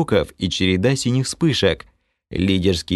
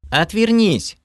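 much higher sharp command; growled order; 12 semitones higher, slower than usual. A narrator's reading. Kalen snarls at Krey to turn around, angry. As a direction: snarl it at him to turn away. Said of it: "рыкнула Кэлен".

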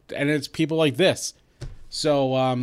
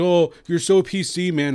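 higher, sneering imitation of a dismissive fan; sneering mock troll; 5 semitones higher, 4 dB louder than usual. Mocking online trolls to his co-hosts, higher sneering voice. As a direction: mimic a smug troll brushing you off. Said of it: "They think".

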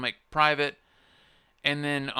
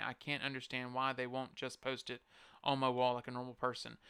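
quiet reading; 12 dB quieter than usual. Real, not acted. Reading the post aloud.

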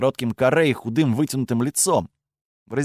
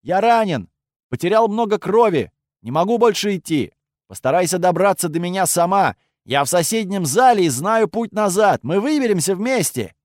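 man down shout; 5 semitones higher than usual. Pretend. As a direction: shout for help, playing wounded. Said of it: "выкрикнул".

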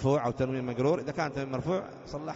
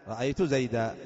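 ordinary delivery

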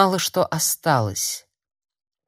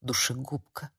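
very soft, haunted thought; inner voice; 3 semitones lower, 9 dB quieter than usual. A narrator's reading. Word haunts girl as she washes off blood, hushed. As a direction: let it echo quietly in the head. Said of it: "билась в голове".

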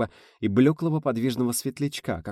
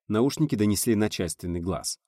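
usual speaking voice

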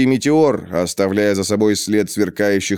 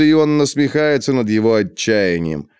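ordinary delivery, nothing marked

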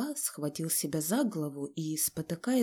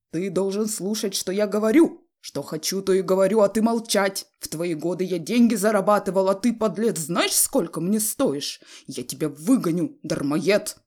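loud domineering tirade; furious shouting; 7 dB louder than usual. A narrator's reading. Father threatening to throw out his son, furious shouting. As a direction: berate him at full voice. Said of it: "крикнул отец и затопал ногами".

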